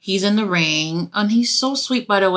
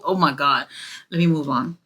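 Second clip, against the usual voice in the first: ordinary talk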